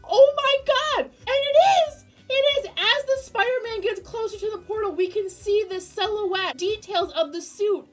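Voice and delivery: high, loud excited screaming; screaming excitedly. Real, not acted. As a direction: shriek it like a thrilled fan. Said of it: "Sorry for my incessant screaming".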